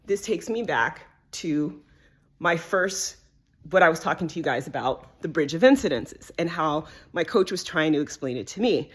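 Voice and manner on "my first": ordinary talk, nothing marked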